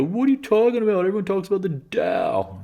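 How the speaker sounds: high, scoffing know-it-all voice; incredulous mock voice. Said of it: "and they go".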